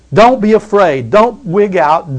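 usual speaking voice

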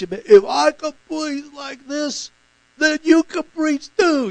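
high, slow, strained impersonation of the preacher's impaired speech; imitating speech impediment. Preacher imitating a preacher with a speech impediment. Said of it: "he says in that, in that speech".

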